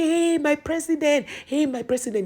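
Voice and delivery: retold excited shout. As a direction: imitate an excited man hailing his president. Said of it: "He started shouting".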